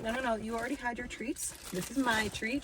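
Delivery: ordinary talk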